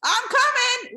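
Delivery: high, loud calling out; mock eager call. Answering the rowers, calling out loud and high. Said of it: "You're like".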